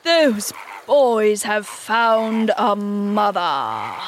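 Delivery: very slow, drawn-out proclamation; passionate. A narrator's reading. Hook breaking the news to his pirates, passionate. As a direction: declaim it, stretching every word. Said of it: "he spoke passionately".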